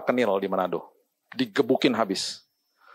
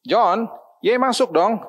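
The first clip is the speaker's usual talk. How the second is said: mother's fond plea; 10 semitones higher, much slower than usual. Retold or imitated. Retold parents parting at door.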